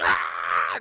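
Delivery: low, drawn-out yell; mock battle scream. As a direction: scream through a wild spin. Imitating Yoda spinning.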